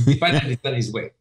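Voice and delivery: put-on Canadian French accent, playfully repeated. Airport manager announcing the pilot, in Canadian French accent. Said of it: "Canadian French accent".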